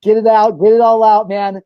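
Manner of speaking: loud, rising encouragement to a friend being sick; cheering a friend on. Retold or imitated. Friend cheers on a buddy who is puking, loud encouragement. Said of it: "you're like".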